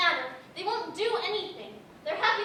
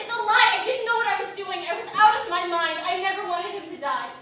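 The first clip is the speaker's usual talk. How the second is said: loud, panicked denial; desperate to be believed; loud frantic pleading denial; 8 dB louder than usual. Acted.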